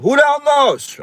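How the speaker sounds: high, loud squeal of "Who the hell knows?"; high catchphrase. Pretend. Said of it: "I can't stop saying".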